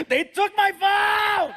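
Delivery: high, drawn-out hammed-up scream; yelling. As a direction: howl it in slow, high outrage like a thwarted kid. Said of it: "he yells at the ground".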